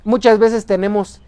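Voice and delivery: ordinary talk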